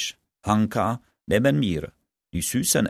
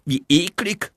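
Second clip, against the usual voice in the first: disgusted; 8 semitones higher than usual. Acted. Disgusted friend complains.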